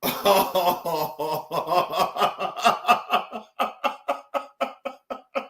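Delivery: helpless, drawn-out laughter; long laughing fit. Real, not acted. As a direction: laugh until you can barely stop. Cracking up at the memory.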